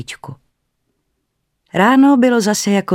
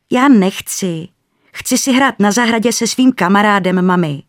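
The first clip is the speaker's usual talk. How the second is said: lightly voiced little boy refusing; a hint of a whiny child; child's protest; 4 semitones higher, 4 dB louder than usual. A narrator's reading. Little boy telling his mother no, lightly childlike. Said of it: "řekl Dominik".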